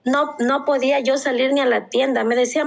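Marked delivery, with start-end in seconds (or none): none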